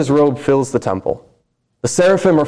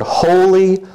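lifted cry of holy; 7 semitones higher, 3 dB louder than usual. Acted. Seraphim's cry in scripture.

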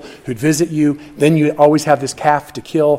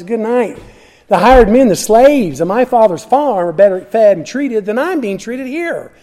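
prodigal son's resolve; 6 semitones higher than usual. Acted. Prodigal son's voiced inner monologue.

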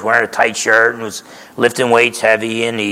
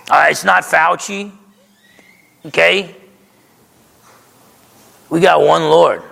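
raised, driving preacher voice, pitched up; preaching at full force; 6 semitones higher than usual. Acted. Pastor exhorting his congregation, preaching at full force. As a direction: hammer the point home to the congregation.

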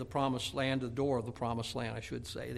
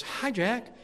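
put-on little kid; 9 semitones higher than usual. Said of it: "some little kid comes by".

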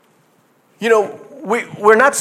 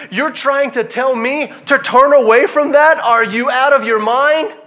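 indignant rebuke; 6 semitones higher, 3 dB louder than usual. Retold or imitated. Psalmist voiced rebuking the world.

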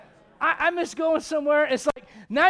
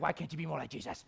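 nagging parents; 8 semitones lower, 13 dB quieter, faster than usual. Retold or imitated. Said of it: "your parents are like".

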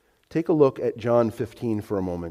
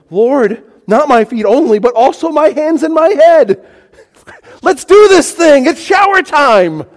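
high, loud, over-the-top enthusiasm; radical eagerness; 12 semitones higher, 9 dB louder than usual. Acted. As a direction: shout it, gung-ho and all in. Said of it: "a radical change in his expression".

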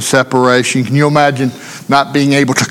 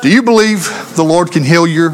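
usual speaking voice

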